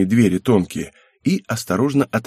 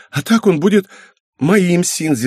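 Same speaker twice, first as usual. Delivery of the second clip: put-on high, eager girl's voice; gushing girlish possessiveness; fidgety excitement; 8 semitones higher, 4 dB louder than usual. A narrator's reading. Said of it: "заелозила она в кресле".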